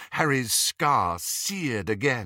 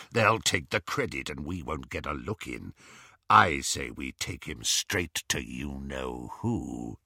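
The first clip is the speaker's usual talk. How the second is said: low werewolf voice; 8 semitones lower, 4 dB quieter than usual. A narrator's reading. Greyback to the snatchers, low and scheming. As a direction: lower gruff scheming.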